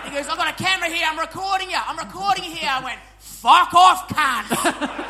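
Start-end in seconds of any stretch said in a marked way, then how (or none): 0.3-2.7 high, loud indignant voice; hammed-up angry driver; mock threatening driver. Imitating the driver threatening to record. 3.2-5.0 loud, high shouted insult; laughing yell at the driver; mock shout. Yelling back at the taxi driver.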